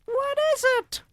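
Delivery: very high, loud witch cry. Acted. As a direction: screech the question in alarm. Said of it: "the others cried out".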